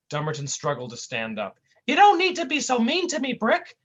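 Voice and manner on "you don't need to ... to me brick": very high, bumbling goofy voice; squeaky clumsy oaf, loud; goofy cartoon sidekick. A narrator's reading. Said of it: "he said".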